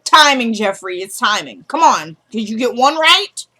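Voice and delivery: mock scolding, building in exasperation; emphatic scolding. Viewer imagining herself telling Jeffree off, exasperated and emphatic. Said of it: "I would have been like".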